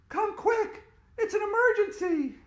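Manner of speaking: high, loud urgent call; urgent shout. A narrator's reading. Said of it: "shouted Andrea".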